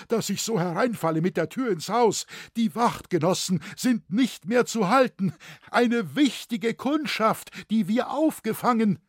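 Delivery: high, loud, excited outpouring; urgent report. A narrator's reading. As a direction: burst in with pressing news. Smith bursts in with urgent news.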